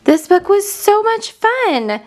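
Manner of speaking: plain reading voice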